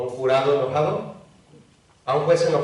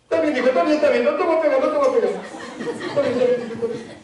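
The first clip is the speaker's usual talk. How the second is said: higher, giving-in dad voice; soft father; 9 semitones higher, faster than usual. Retold or imitated. Preacher voices a father giving in to his crying son, soothing. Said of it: "Y el papá".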